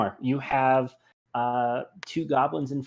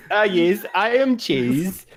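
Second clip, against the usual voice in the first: slow proud voice; 6 semitones higher, 3 dB louder, slower than usual. Acted. GM voices Cheese character.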